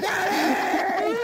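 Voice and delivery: put-on long, very high child's cry; mock baby wail. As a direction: wail for your father as a fallen baby. Baby calls from the ground.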